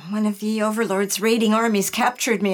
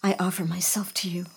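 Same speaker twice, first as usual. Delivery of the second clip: low, hushed recitation; soft, half-whispered pledge; whispered prayer; 4 semitones lower, 5 dB quieter, faster than usual. Acted. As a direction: murmur a devotion under the breath. Murmured prayer alone.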